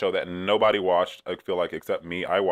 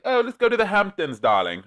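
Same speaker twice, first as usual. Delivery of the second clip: high, louder posh British "darling" voice; playful British accent mocking rich friends; 12 semitones higher, 6 dB louder than usual. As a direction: swan it in a camp British accent. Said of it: "I don't know why I'm British all of a sudden".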